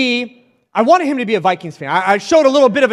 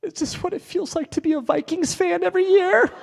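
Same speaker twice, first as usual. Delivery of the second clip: put-on higher, softer kid's voice; son's voice; 9 semitones higher, 6 dB quieter than usual. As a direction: ask it like an innocent little boy landing a jab. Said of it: "He goes".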